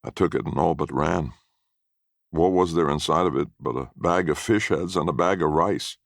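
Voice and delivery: usual speaking voice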